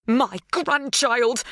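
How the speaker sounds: "My grandchild" slow and high, each word weighted; emphatic. A narrator's reading. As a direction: stake a fierce claim on "My grandchild". Helen jabs, slaps her chest.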